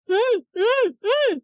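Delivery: high, muffled hums through a covered mouth; muffled moaning. A narrator's reading. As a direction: moan with a gagged mouth, high and strained. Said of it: "என முனகி". (வாய் அடைக்கப்பட்ட முனகல்)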